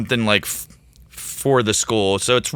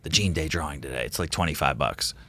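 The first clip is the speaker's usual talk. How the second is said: quietly at the table; 4 semitones lower, 9 dB quieter than usual. Retold or imitated. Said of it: "like, quietly at the table".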